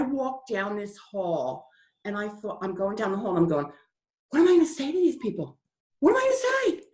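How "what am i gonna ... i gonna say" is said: high-pitched, fretful self-questioning; mock panic. Woman walking down the hall to mourners, acted high panic. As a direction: climb higher on the repeated question, at a loss.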